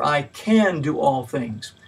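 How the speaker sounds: "can" hit hard with a falling tune, slow; stress on CAN. Shown as a demonstration. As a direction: press on "can" as if it settles the matter. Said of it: "each time placing a different emphasis".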